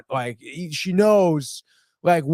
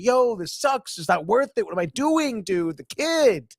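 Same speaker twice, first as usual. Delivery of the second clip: high, mock-upset complaint; exasperated retold outburst, high-pitched; acted distress; 7 semitones higher than usual. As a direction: play someone upset, pitch leaping up. Voices Sophia's upset to the doctor.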